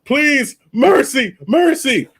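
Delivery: high, begging plea for mercy; mock pleading. Host mocks someone begging for mercy, high pleading. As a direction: squeak it out like begging for your life. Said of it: "like, when someone asking".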